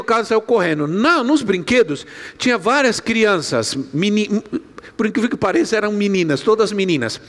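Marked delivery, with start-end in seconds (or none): none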